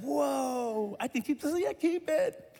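put-on a touch of wide-eyed kid wonder; amazed kid.

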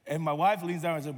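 ordinary talk

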